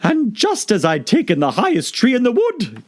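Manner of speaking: harassed bird listing its woes; fretful pigeon. Acted. Pigeon complaining to Alice, fretful.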